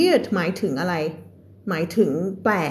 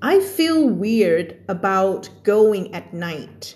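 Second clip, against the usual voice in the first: very slow, careful read; slow example sentence; much slower than usual. Shown as a demonstration. Teacher reads an example sentence to students, very slowly. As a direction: drag each word out clearly.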